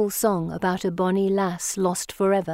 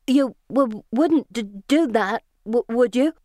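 frightened, stammering and higher; horrified stammer; 5 semitones higher, 3 dB louder than usual. A narrator's reading. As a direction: stumble over the words in fright.

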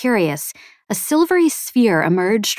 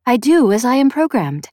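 higher feminine AI; feminine sphere voice; 5 semitones higher, 4 dB louder than usual. A narrator's reading. Sphere answers its maker's challenge, higher feminine AI voice. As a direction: answer as a programmed machine, pitched up. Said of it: "a feminine voice said".

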